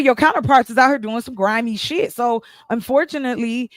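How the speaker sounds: usual speaking voice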